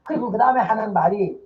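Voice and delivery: ordinary delivery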